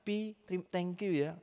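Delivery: put-on high, drawn-out child's voice; son's offhand goodbye. Son leaving the car without a proper goodbye, imitated high and slow. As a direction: imitate a son tossing off thanks. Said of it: "nggak pamitan gini".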